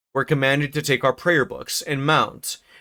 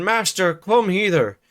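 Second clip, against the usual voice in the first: put-on higher, louder call in a broad rustic accent; shouting old servant; 6 semitones higher, 3 dB louder than usual. Joseph calls to the master in a high rustic shout. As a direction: call the master across the house, outraged. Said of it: "shouted our chaplain".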